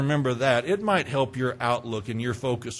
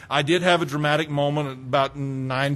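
ordinary delivery

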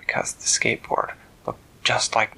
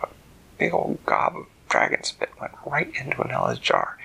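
usual speaking voice

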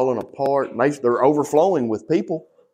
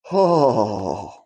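choked cry; 4 semitones lower than usual. Real, not acted. Overwhelmed, remembering the Lord's answer.

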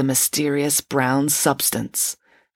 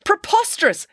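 very high, scoffing outburst; scornful exclamation; 4 dB louder than usual. A narrator's reading. Teacher scoffs at a stolen homework excuse, exclaiming. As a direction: scoff in high-pitched disbelief. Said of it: "exclaimed Mister Spencer".

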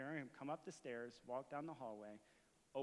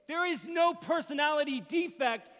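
slow, high, shouted declaration; full-force preacher, hammering each phrase; impassioned preaching; 13 semitones higher, 18 dB louder, slower than usual. Real, not acted. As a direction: thunder it out to the congregation.

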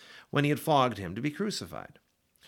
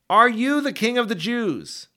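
higher, louder, slow probing question; Pilate's demanding question; 9 semitones higher, 7 dB louder than usual. Acted. Pilate challenging Jesus before the priests, raised and slow. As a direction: press the prisoner slowly, voice raised.

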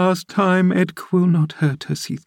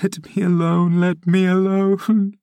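sobbing plea; 9 semitones higher than usual. A narrator's reading. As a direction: beg through sobs, pitched high, stretching the words. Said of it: "sobbed Catherine".

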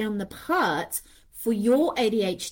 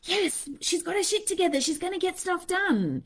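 lightly voiced higher cheer; mock cheerleader; 7 semitones higher, 3 dB quieter than usual. Anxiety mistaking a high for competence, lightly mocking. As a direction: mimic an approving inner voice. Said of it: "our anxiety can mistake that for".

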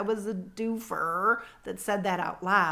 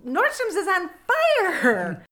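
high, loud, slow alarmed cry; a stretched, shrieking announcement with a laugh; shocked exclamation; 10 semitones higher, 7 dB louder, much slower than usual. Retold or imitated. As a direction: blurt it out in stunned alarm. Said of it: "I had to repeat it".

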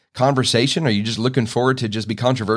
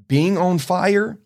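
slow and much higher on "being on fire"; drawn-out emphasis; 7 semitones higher, much slower than usual. Real, not acted. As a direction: stretch out "being on fire" slowly and high. Dramatic reading of scripture.

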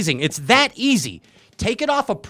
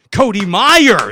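announcer call; 3 semitones higher, 7 dB louder, slower than usual. Acted. Host introducing guest.

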